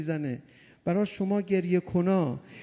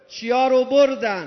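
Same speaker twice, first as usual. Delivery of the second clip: higher, louder pointed question; loud demand; 4 semitones higher, 6 dB louder than usual. Real, not acted. Preacher calling the question out to the crowd, higher and louder. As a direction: call the question out again.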